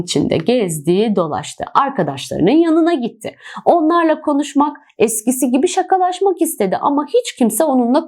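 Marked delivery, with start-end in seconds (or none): none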